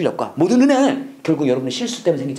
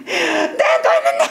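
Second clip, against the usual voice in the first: much higher, slow hammed-up wailing with a laugh; mock tearful repentance; slower than usual. Preacher parodies people crying in repentance, mock wail. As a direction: parody someone sobbing over their sin. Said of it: "사람들이 울면서 회개해요".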